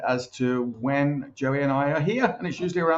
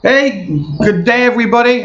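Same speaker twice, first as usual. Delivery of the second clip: put-on Australian accent; 7 semitones higher, 11 dB louder than usual. Host greets viewers in a put-on Australian accent. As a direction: stretch out a cheery Australian g'day. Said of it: "I don't know why I'm speaking Australian".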